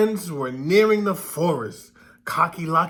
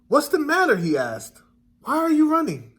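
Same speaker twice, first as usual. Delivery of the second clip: much higher, louder turkey voice; a fussy turkey calling out; curious turkey; 8 semitones higher, 4 dB louder than usual. A narrator's reading. Turkey asks the running hen and duck, high and curious.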